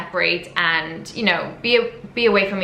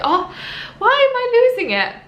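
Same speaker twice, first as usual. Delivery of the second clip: much higher and louder, voice flying up; overwhelmed squeal; 5 dB louder than usual. Retold or imitated. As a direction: let the feeling burst out, high. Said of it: "I just felt like".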